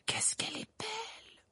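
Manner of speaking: whispered wonder; murmur. A narrator's reading. Schoolgirl admiring the unicorn, murmured. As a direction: whisper it in soft awe. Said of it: "murmura Lavande Brown".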